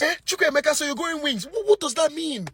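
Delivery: scolding mom. Acted. Mom confronting her son Chukwuemeka, high indignant scold. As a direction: demand an answer like an outraged mother.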